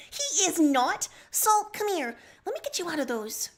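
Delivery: high, loud eager Nepeta voice. Acted. Nepeta rescuing Sullux from the brig, high and eager. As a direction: squeak it out, rushing to free him. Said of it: "Nepeta says".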